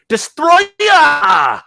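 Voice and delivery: high, drawn-out scream of a monster's name; James Ralph impression. Podcast guest quotes a reviewer's catchphrase to co-hosts, screamed impression. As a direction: yell it long, loud and way up high. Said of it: "as James Ralph likes to say".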